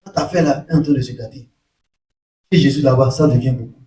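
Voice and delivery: low, hushed imitation of a complaint; lightly voiced worried disciples. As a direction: confide the problem to the master, voice dropped. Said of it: "les disciples de Jean-Baptiste viennent".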